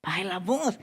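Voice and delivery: hushed, low complaint from a bystander; acted hushing of someone too noisy; shush, then murmur a low reproach. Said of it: "me va a decir alguien".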